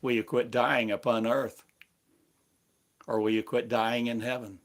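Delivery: ordinary delivery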